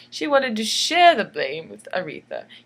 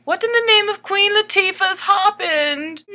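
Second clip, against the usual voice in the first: higher, louder outraged cry; outraged ruler; 8 semitones higher, 6 dB louder than usual. Acted. Alfonso yelling about the broken law. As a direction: blurt it like a furious king. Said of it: "he's saying".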